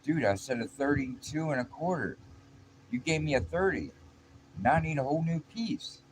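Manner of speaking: high-pitched retold complaint; acted exasperation. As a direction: play a fed-up coworker correcting a mistake. Coworker complains about wrong cut.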